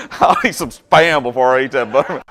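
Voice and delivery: loud, laughing delivery; cracking up while talking; laughing through words. Real, not acted. Laughing through own joke.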